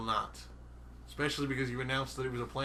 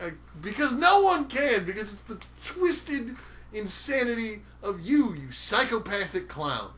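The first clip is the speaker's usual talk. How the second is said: mock angry; 7 semitones higher, 6 dB louder than usual. Real, not acted. Heated banter insult.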